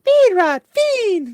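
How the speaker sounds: high falling creature call of Fiendrod; very high mock Pikachu cry. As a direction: squeak it like a Pokémon. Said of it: "It's a Pikachu".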